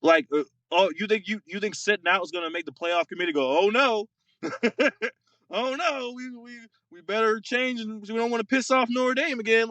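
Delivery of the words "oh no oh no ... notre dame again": higher, hammed-up fake worry; mock panic. Podcaster imitates the playoff committee in mock, higher-pitched fake panic. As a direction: pretend to fret like a nervous committee.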